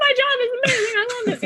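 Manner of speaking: very high, squeaky mock crying; hammed-up weeping with joy, half laughing; imitated happy sobbing. Coach after an emotional session, mock sobbing. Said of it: "got off that call and just sobbed".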